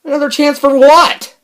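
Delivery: high, loud, indignant question; incredulous shout. Real, not acted. Vlogger answering Siri's plea, incredulous high shout. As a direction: shout back in disbelief, pitch way up. Arguing with the phone assistant.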